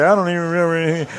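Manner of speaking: fast, high mock excuse from a newlywed; rushed mock excuse. Preacher mocking couples who forget their vows, rushed. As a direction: rattle it off like a flustered newlywed. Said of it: "I've heard people say".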